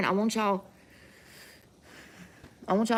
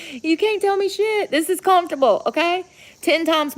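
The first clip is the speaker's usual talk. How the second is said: excited; 9 semitones higher, 7 dB louder than usual. Real, not acted. Frolicking in grass, showing off.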